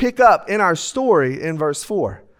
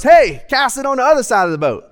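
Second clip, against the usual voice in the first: raised, higher-pitched call; hollering; 5 semitones higher, 4 dB louder than usual. Acted. Jesus advising fishermen from shore, hollering. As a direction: call instructions out to the boat. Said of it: "I see Jesus hollering right here".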